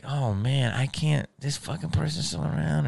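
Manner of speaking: soft, under-the-breath complaint; muttered dismay. Retold or imitated. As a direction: grumble it quietly, as if to yourself. Mutters weary reaction to person.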